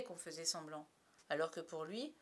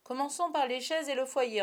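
loud, higher-pitched order; forceful leader's instruction; commanding; 6 semitones higher, 11 dB louder than usual. A narrator's reading. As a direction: launch the work like a foreman. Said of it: "ordonna Peter".

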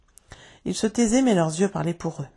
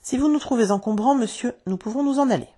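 higher-pitched wounded pride; proud, embarrassed; 6 semitones higher, 3 dB louder than usual. A narrator's reading. Proud twin answers Monsieur Darling's mean remark, higher and stung. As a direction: offer to leave with injured dignity. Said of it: "ne manquait pas de fierté et dit en rougissant".

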